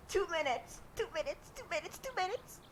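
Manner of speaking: small, high, soft begging; pleading. Real, not acted. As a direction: plead in a tiny high voice, rising further. Begging friend for time.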